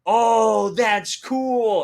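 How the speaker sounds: high, very loud drawn-out exclamation; impressed reaction. Retold or imitated. Celebrity admiring a fan's tattoo, in a loud impressed cry. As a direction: exclaim it big, hammed-up admiration.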